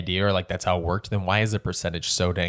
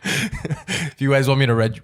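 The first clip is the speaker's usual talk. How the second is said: slow, high storyteller lilt; bedtime story voice; 4 semitones higher, slower than usual. Shown as a demonstration. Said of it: "read you a bedtime story".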